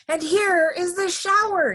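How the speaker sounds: high, loud triumphant announcement; shouting. A narrator's reading. Alkaloo arrives with the hose, high loud shout. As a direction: shout it out high like a big reveal. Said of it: "he shouted".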